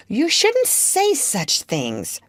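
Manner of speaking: high, slow, indignant comeback; retort. A narrator's reading. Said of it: "Peter retorted".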